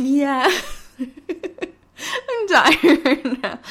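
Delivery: laughing through the words. Real, not acted. Retelling the confrontation while laughing. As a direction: crack up while talking.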